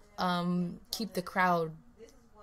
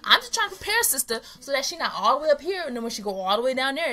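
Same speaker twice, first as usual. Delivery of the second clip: excited; 6 semitones higher, 9 dB louder than usual. Real, not acted. Sisters argue, defending herself.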